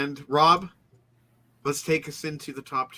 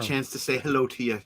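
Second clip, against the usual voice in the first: ordinary delivery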